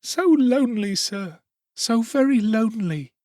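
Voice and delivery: put-on high, tearful child's cry. A narrator's reading. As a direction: sob out the loneliness. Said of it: "cried the child".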